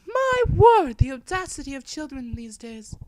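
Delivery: put-on high, loud outraged old woman; prim indignant lady. Offended woman scolding the rude narrator, high and loud. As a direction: clutch your pearls in shock, high-pitched.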